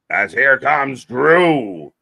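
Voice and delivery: loud, drawn-out entrance call; ring announcer. Pretend. Co-host announces Drew's entrance, mock ring announcer. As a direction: stretch the name like an arena announcer.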